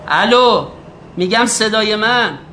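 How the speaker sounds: بلندتر roepen: "صدای منو داری؟" with a lift on منو; calling out on the phone. Acted. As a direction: raise the voice into a weak phone line. Shouting into a bad phone line.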